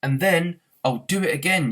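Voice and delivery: angry yell while running. A narrator's reading. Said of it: "yelled Arthur".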